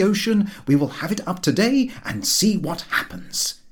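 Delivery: a determined, rising tirade about the winds. A narrator's reading. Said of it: "He grasped the fact that I was determined".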